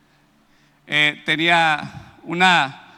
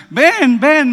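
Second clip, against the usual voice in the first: higher, louder call to come over; woman urging the young man to come closer, called out; 8 semitones higher, 6 dB louder than usual. Retold or imitated. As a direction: wave someone back with your voice. Woman calls him back over.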